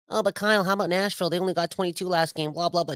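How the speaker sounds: high, whiny mock voice of a doubter; mocking a critic. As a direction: parrot the naysayer, dismissively. Mocking an imagined critic's reply.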